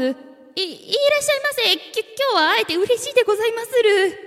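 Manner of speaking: high, fast, tense stammering greeting; nervous voice. Acted. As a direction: blurt it out, stiff with nerves. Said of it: "緊張しきった声を出してしまいました".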